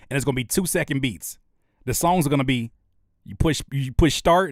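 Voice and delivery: normal talking voice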